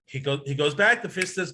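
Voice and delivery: ordinary talk, nothing marked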